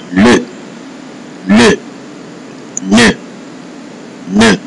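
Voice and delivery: "le" and "ne" with the vowel of "above"; short French words, each on its own; French schwa E. Shown as a demonstration. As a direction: say "le", "ne" as "luh", "nuh". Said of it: "it sounds like above".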